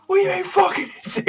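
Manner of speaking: very high, loud mock outrage; losing it. Asta reacting to being called an insect, losing it. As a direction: imitate someone flipping out, high-pitched and fast. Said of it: "He just loses it".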